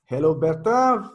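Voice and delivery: usual speaking voice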